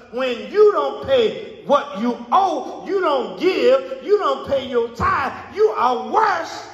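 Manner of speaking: slow, hammered pulpit delivery; emphatic preaching. Real, not acted. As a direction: build phrase by phrase, rising to the accusation. Preaching at full force.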